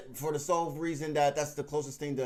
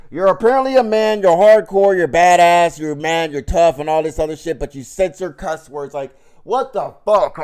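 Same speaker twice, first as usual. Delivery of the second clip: angry rant; 3 semitones higher, 14 dB louder than usual. Real, not acted. Ranting at online tough guys.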